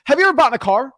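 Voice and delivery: high, exaggerated salesman enthusiasm; hammed-up, excitable buyer; matched high energy. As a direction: pitch the seller with over-the-top energy. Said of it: "you gotta match energy".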